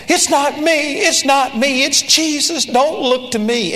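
high-pitched, insistent mock disclaiming; hurriedly deflect the credit, pitched well up; acting out a flustered denial; imitated frantic protest. Acting friend's alarmed first reaction.

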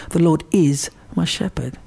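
"is" held and emphasised; firm weight on the word "is"; stress on is. Shown as a demonstration. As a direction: press on "is", then finish the verse. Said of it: "is emphasised".

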